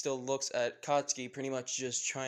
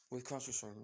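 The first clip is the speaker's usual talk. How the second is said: ordinary delivery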